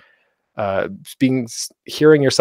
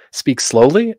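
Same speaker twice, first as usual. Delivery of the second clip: ordinary talk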